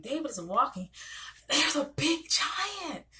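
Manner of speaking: slow, high, wide-eyed telling of the giant; dramatic storybook reading for kids; animated storyteller. Shown as a demonstration. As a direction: draw the little ones in with a big storybook voice. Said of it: "I'm very animated, particularly for my young kids".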